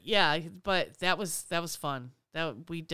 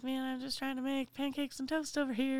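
higher whiny protest; exasperated pancake cook; 8 semitones higher, 4 dB quieter than usual. Retold or imitated. Cook on the pancake station, higher whiny protest. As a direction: plead to be left alone. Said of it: "you're like".

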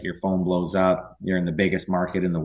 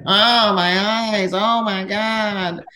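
much higher, slow, hammed-up wail; mock freaking out; 12 semitones higher, 4 dB louder, much slower than usual. Roommate Phil waking up with sun in his eyes, mock wailing. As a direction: play the roommate moaning dramatically, drawn out. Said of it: "he's freaking out".

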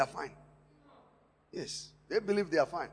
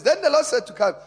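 preached scripture; 6 semitones higher, 12 dB louder than usual. Acted.

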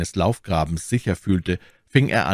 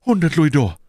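high-pitched, louder admission; high voice of a cornered hireling; confessing soldier; 9 semitones higher, 6 dB louder than usual. Acted. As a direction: blurt it out in the captive's high voice. Wounded soldier confesses his fee.

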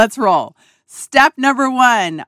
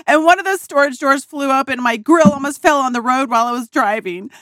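rapid, higher, breathless list of disasters; frantic rant; 4 semitones higher, faster than usual. Retold or imitated. Daughter venting RV breakdown to her dad, rapid frantic rant. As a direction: pour out every problem in one rush. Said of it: "that's pretty much how I sounded".

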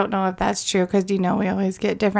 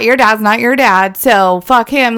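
loud mock mom; 3 semitones higher, 7 dB louder, slower than usual.

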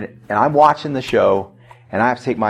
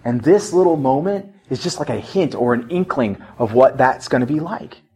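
usual speaking voice

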